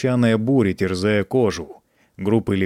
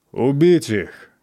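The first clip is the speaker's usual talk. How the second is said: commanding shout; 4 semitones higher, 3 dB louder, slower than usual. Acted. Emperor orders the execution.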